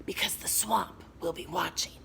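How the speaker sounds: unvoiced scratchy threat; menacing whisper. Swamp threatening.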